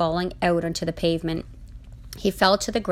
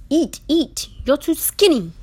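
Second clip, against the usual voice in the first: put-on loud, high accented urging; punchy, high-pitched command to eat; insistent urging; 9 semitones higher, 6 dB louder than usual. A narrator's reading. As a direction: push food on them loudly. Francois urging food, mouth full.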